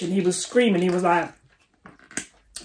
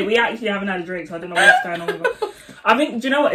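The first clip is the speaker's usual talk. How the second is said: fast giggling talk; laughing; 2 semitones higher, much faster than usual. Real, not acted. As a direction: rush it through laughter. Laughing fit.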